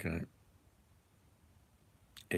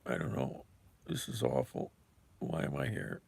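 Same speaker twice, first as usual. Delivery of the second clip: softer, mopey mock-despair voice; mock low energy; 3 semitones higher, 6 dB quieter than usual. Answering a viewer asking for low energy, mock mopey. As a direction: deflate on request, half-joking. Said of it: "You want low energy, man?".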